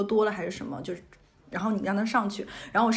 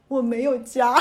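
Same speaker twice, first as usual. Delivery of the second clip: a touch louder, overacted tragic heroine; dramatic tragic line; 5 dB louder, slower than usual. Answering the subway worker, a lightly hammed-up tragic line. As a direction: play it like a soap opera victim, lightly. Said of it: "那一刻我真的是戏剧附体".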